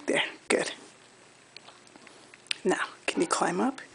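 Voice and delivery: soft whisper, barely voiced. Real, not acted. Whispered encouragement to baby.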